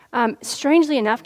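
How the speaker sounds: ordinary talk, nothing marked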